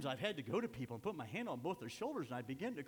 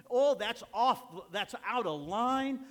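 mock indignant; 9 semitones higher, 13 dB louder, slower than usual. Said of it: "it's taboo to confront anybody".

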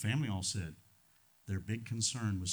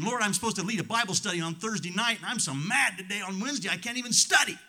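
exasperated plea; 8 semitones higher, 4 dB louder, faster than usual. Retold or imitated. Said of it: "crying out to God and saying".

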